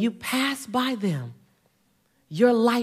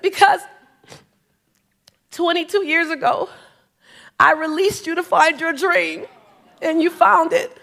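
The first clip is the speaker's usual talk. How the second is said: higher, lightly voiced former boss, moved; 7 semitones higher, 3 dB louder than usual. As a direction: give the old boss's reveal, a touch tender. Boss voiced in story, far higher.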